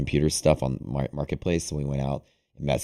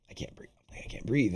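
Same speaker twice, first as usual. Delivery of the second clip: airless, barely voiced re-enactment of choking; mock panicked gasping; 6 semitones higher, 6 dB quieter than usual. Retelling the shower panic to listeners, imitated breathless gasp. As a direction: act out gasping for air, very soft. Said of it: "I was, like, freaking out".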